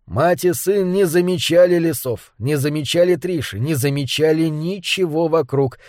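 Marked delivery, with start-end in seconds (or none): none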